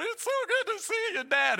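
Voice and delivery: very high, loud gushing shout; overjoyed greeting at full volume; yelling greeting. Retold or imitated. Friend swinging him around at the airport, yelled greeting. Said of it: "he starts yelling across the airport".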